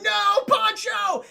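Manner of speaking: long, drawn-out high yell; loud, slow movie-hero scream; anguished mock shout. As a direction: cry out for a falling comrade, hammed-up. Arnold cries out as Pancho dies.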